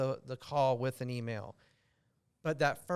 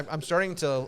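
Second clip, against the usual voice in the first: ordinary talk, nothing marked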